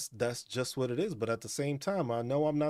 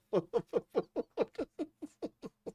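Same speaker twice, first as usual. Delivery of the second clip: laughter; 11 semitones higher than usual. Real, not acted. Laughing at the retold line.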